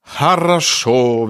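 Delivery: put-on slow, drawn-out Russian-accented khorosho. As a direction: stretch it out like a Russian would. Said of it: "wie der Russe sagt".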